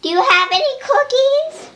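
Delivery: a tiny toy Vader begging politely for pie; high squeaky villain asking nicely; Darth Vader voice. Pretend. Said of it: "then Darth Vader asks".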